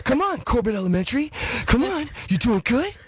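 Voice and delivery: high, breathless school announcements; winded actor reading the morning announcements; Mark Wahlberg. Acted. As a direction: pitch it up, as if out of breath. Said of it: "He's doing high school announcements".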